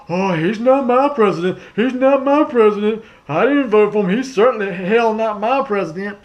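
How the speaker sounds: high, loud whiny mock protest; mocking coworkers. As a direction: sneer it like a sulky coworker. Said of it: "friends, uh, work associates".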